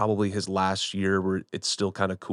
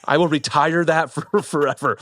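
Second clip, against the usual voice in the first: talking through laughter, louder and higher; laughing; 6 semitones higher, 4 dB louder than usual. Real, not acted. Podcast guest teased about his Southern phrase, laughing. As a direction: laugh while giving in, breaking up mid-sentence.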